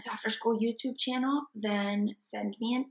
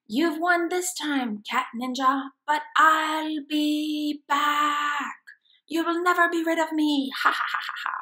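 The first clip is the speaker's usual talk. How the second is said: higher, louder vow of revenge ending in a cackle; defiant villain exit; 6 semitones higher, 5 dB louder than usual. Acted. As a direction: threaten as you flee. Villain retreat threat.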